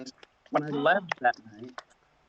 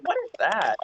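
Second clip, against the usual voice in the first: puzzled; 7 semitones higher than usual. Retold or imitated. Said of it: "I was like".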